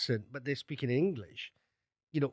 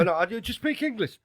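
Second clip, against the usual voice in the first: British tourist abroad; 8 semitones higher, 4 dB louder than usual. Retold or imitated. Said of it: "And they go".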